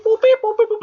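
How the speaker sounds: high, chirpy mock beep-boop; high mock droid beeps; imitating droid beeps. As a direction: beep it like a little robot. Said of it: "he's just beep".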